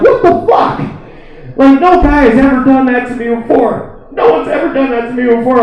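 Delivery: high-pitched, outraged woman; imitated indignation. Woman mad at her date for stopping, imitated outrage. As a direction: act out her getting mad, voice pitched well up. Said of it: "got mad at me".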